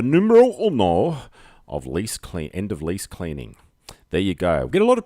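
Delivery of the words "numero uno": mock Spanish flourish. Hammed foreign phrase in a plug.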